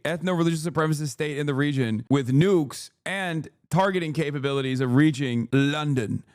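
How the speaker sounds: ordinary delivery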